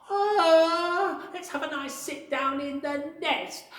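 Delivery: high, pleased little bird voice; a bird sinking into its nest with relief; contented bird. Acted. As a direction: sigh happily as a bird settling in. Bird voice settling down.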